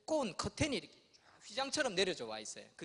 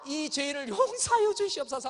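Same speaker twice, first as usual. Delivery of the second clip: preacher prayer; 8 semitones higher, 8 dB louder than usual. Retold or imitated. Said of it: "하면서".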